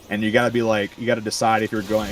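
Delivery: normal talking voice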